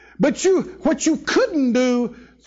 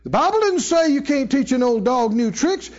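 ordinary delivery

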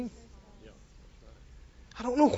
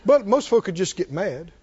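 ordinary talk

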